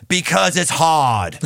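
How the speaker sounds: put-on slow, weighty Boston accent on it's hard; JFK Boston accent. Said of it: "with his Boston accent".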